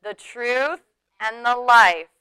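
slow, measured recitation; slow, solemn unison reading; congregational recitation. Real, not acted. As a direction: lead the room in reciting scripture. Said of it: "say it with me".

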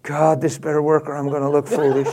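mock nervous prayer under the breath; under his breath. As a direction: say it fast and privately, dropping the voice. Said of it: "standing there under his breath going".

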